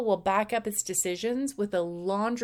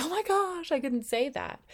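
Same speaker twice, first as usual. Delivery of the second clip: much higher, flustered gasp of protest; shocked disbelief; 8 semitones higher than usual. Retold or imitated. A listener balking at helping the neighbour, shocked. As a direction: squeal like the idea is outrageous. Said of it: "You're like".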